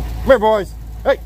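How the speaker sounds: calling the dogs. Real, not acted. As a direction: call them in from across the yard.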